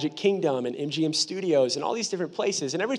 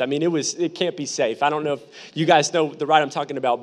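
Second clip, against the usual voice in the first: ordinary delivery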